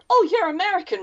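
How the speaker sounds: high-pitched imitation of people guessing; mock exclamation. Strangers hearing her accent, mock exclamation. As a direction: pitch jumps up in mock recognition.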